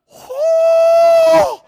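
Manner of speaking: high whooping whoosh of the sea; a loud high whoo as the Red Sea parts; whooshing shout. Retold or imitated. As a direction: belt out the sea splitting open. The sea parting.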